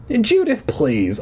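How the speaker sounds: high call falling to a plea; cried out. A narrator's reading. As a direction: cry out in protest. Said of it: "I cried".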